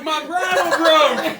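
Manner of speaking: loud, slow, laughing shout. Retold or imitated. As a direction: yell it across the field, laughing. Shouting to the girls while riding.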